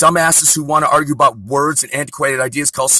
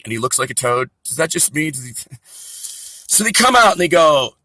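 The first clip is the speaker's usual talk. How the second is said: ordinary delivery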